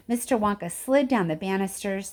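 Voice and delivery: plain reading voice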